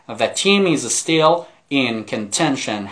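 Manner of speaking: slow model reading. Shown as a demonstration. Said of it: "teacher models the phrase".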